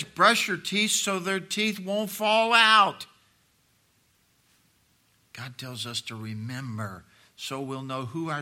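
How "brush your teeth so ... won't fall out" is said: high, drawn-out nagging, growing loud; insistent parent. Retold or imitated. Preacher voicing parents telling kids to brush, loudly insistent. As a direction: drive it home like a parent imitated in exasperation.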